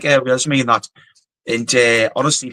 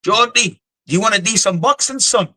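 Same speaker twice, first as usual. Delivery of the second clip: higher-pitched mock Scottish challenge; higher imitation of a Scottish squaddie; imitated Scottish accent; 6 semitones higher than usual. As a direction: put on a little Scottish guy calling you out. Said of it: "one of those little Scottish guys".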